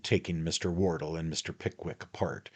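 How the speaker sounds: ordinary delivery, nothing marked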